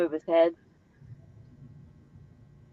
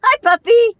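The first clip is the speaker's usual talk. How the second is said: high, loud puppy talk; 8 dB louder than usual. Real, not acted. Talking to a dog on camera.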